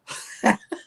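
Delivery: short nervous chuckle; a shy, uneasy little laugh. Real, not acted. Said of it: "that's the nervous laugh".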